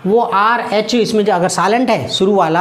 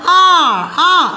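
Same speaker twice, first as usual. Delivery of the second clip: calling out; 7 semitones higher, much slower than usual. Shown as a demonstration. Teacher mock-calls आर to show the sound. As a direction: call out आर as if calling someone. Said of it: "ऐसे करके पुकारा आपने".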